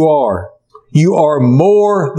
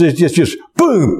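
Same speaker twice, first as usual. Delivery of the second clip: normal talking voice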